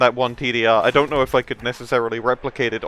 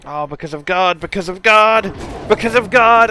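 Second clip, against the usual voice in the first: each repeat louder and higher; rising excitement; 5 semitones higher, 3 dB louder than usual. Real, not acted. Friend hyping a play, building louder each time. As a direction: build it up, getting more worked up.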